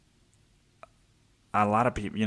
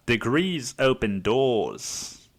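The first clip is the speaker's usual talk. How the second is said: put-on very slow, stretched Aussie accent; hammed-up Australian sage; much slower than usual. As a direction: draw it out in mock Australian. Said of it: "say it in a fucking Australian accent".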